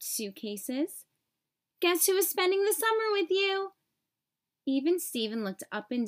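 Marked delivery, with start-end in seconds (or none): 1.8-3.7 high, fast and eager; excited cousin. A narrator's reading. Odd Todd announcing to his cousin that he's staying, eager and fast. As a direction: rattle it off with glee.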